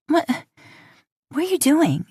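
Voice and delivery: startled and sniffling. Acted. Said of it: "still sniffling".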